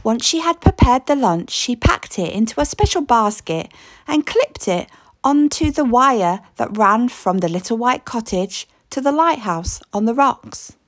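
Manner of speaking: usual speaking voice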